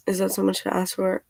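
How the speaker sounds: normal talking voice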